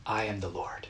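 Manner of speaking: soft, reverent declaration; quiet, weighty voice of God; hushed and solemn. Retold or imitated. God's words, hushed.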